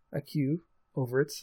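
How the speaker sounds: ordinary talk, nothing marked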